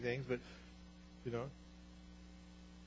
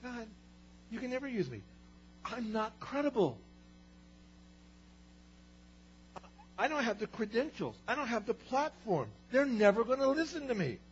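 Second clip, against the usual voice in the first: higher, complaining excuses; whiny arguing; 10 semitones higher than usual. Retold or imitated. Moses arguing with God, high and complaining. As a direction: protest each excuse.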